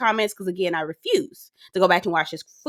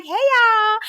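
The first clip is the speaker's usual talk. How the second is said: high-pitched sing-out hello; imitated cheery greeting; 13 semitones higher, 3 dB louder than usual. Imitating Mia walking in on the women, cheery greeting. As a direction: call it out high, as her walking in. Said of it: "she's like".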